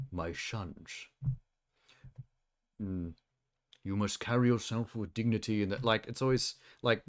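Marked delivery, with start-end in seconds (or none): 0.1-5.6 a hint of a dignified mentor rat; regal monkish Splinter. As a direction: intone it like a serious old sensei. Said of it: "monkish voice".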